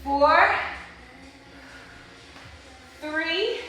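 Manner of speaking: counting while arms strain; strained count mid-exercise; shaking with effort. Real, not acted. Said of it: "my arms are shaking".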